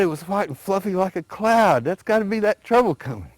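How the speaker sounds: a lightly voiced alligator getting worked up. A narrator's reading. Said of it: "The alligator got more excited".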